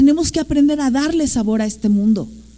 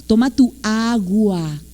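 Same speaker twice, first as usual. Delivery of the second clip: slow, exaggerated AGUA, lower voice; baby-talk parent; 3 semitones lower, slower than usual. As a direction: hand it over, drawing out "agua" for a toddler. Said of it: "porque hablamos chistoso con ellos". (como papá hablándole chistoso al niño, "agua" muy alargado)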